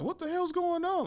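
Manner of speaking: high-pitched bewildered reaction; baffled, alarmed squeak of a question; shocked onlookers. Retold or imitated. Shocked onlookers voiced in story.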